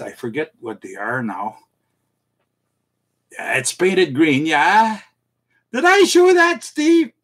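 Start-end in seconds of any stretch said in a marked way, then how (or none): 5.7-7.1 mock bafflement. Mock baffled question to chat.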